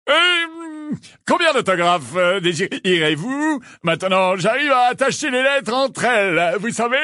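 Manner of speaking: high, gushing character voice with halting starts; exuberant addled celebrity, proud. Acted. Lockhart offers autographs to his visitors, exuberant and proud.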